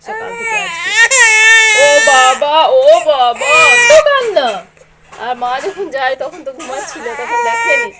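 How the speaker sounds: put-on high, loud sing-song coaxing the baby; loud, lilting baby talk to the infant. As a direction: coo brightly up at the little one. Mother comforts crying baby.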